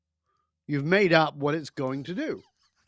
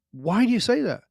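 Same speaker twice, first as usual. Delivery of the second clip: higher, louder retold question; puzzled challenge; 6 semitones higher, 5 dB louder than usual. Man questioning his mate's claim, baffled. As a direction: push back, acting baffled.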